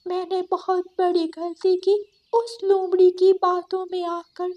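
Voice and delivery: high, pleading goat voice begging forgiveness; sobbing apology. Acted. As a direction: beg your friends to forgive you, near tears. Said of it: "फूट फूट कर रोने लगती है". (रोती हुई माफ़ी)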